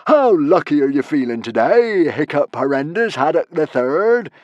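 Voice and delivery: loud, high, swooping question; gloating theatrical bully; taunting villain. Acted. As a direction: goad the captive with relish. Villain taunts his captive.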